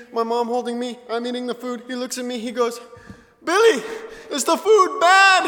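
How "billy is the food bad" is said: high, loud, drawn-out question; alarmed shout. Retold or imitated. Host brother sees him crying over food, alarmed shouting. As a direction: imitate an overdramatic worried shout.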